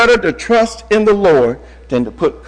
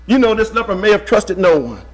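ordinary talk, nothing marked

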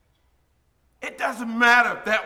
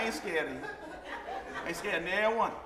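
ordinary talk, nothing marked

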